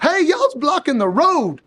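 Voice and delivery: high, loud twangy yell from a passing lady. Retold or imitated. Lady driving past yells at the crash survivors, high loud call. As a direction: shout it up high like an annoyed driver. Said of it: "This lady yells out".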